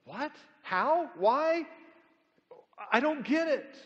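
high, loud, bewildered questions; a confused man who cannot follow; baffled Nicodemus. Acted. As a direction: throw up your hands, totally lost. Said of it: "Because he says".

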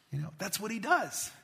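ordinary talk

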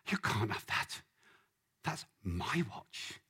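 breathy, slow clinging voice; possessive whisper. Acted. Man refusing God his watch, possessive whisper. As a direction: hold on tight, like a child.

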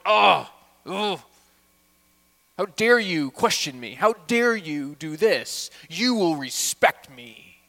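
loud, slow indignant scolding; mock angry parent. Preacher voicing a harsh parent at home.

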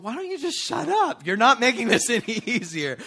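high, loud, fed-up mock outburst; exasperated inner voice. Wife's inner voice at the rude guy, exasperated. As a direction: blurt out the silent frustration. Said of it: "on the inside, she's going".

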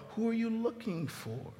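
soft, gentle question; tender. Acted. Preacher repeating Jesus's question to Mary, tender. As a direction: let the question land softly.

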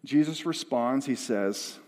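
ordinary delivery

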